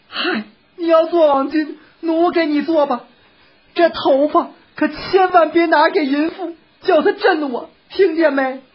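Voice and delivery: high woman's voice, insistent; coaxing wife. A narrator's reading. Wife offering to make it but warning her husband, coaxing. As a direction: give in, then warn him in a high voice.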